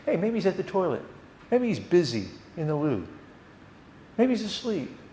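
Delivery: a hint of mocking, sing-song jeer; mock taunting. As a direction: lightly tease the absent god. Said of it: "he's taunting him with".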